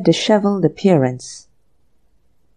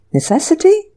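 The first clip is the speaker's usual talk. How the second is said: high, startled echo; shocked question; 10 semitones higher than usual. Acted. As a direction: repeat it in disbelief. Anthony shocked by butler.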